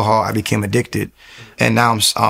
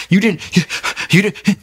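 panicked insistence, much higher; freaking out; 9 semitones higher than usual. Retold or imitated. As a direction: insist in a frightened, pitched-up rush. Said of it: "I'm freaking out".

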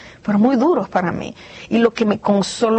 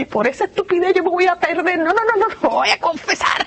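rising, higher panicked self-talk; self-reproach; 9 semitones higher than usual. Retold or imitated. A believer panicking over a sin, higher and agitated. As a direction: scold yourself in a rush of alarm. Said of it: "Y uno dice".

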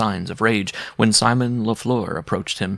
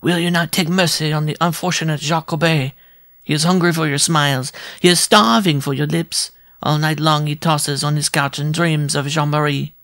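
higher, ardent little courtier begging; pleading dwarf suitor; 6 semitones higher, 3 dB louder than usual. Acted. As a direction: woo her passionately, higher. Dwarf woos the giantess.

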